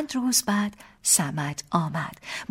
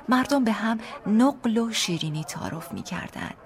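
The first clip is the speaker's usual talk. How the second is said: usual speaking voice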